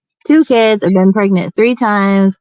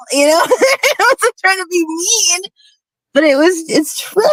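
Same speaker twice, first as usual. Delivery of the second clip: laughing; 10 semitones higher than usual. Real, not acted. Laughing through retold family story.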